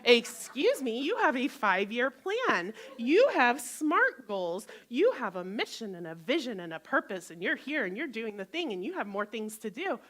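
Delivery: loud, indignant voice of the brain; loud, insistent self-talk, acted out; nagging inner critic. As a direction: scold like a bossy inner critic, hammering the points. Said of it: "my brain goes".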